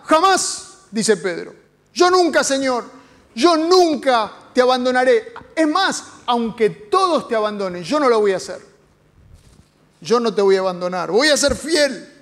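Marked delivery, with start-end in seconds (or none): 0.0-0.9 indignant protest. Acted. Peter protesting loyalty to Jesus. 1.9-12.1 fervent vow. Acted. Peter protesting loyalty to Jesus.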